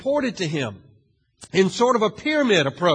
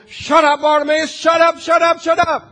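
higher, louder, repeated angry yell; crowd yelling; 10 semitones higher, 8 dB louder than usual. Acted. Angry crowd shouting down the blind beggar, loud and repeated. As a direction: yell him down over and over. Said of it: "the crowd yells all the more".

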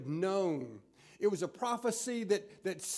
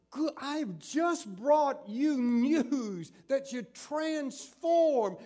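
slow, rising outrage, higher as it goes; indignant rebuke; 5 semitones higher, slower than usual. Acted. Angel Gabriel scolding Zacharias for talking back, slow indignant rebuke. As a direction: drag out a scolding mother's how dare you. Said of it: "It's like Gabriel says".

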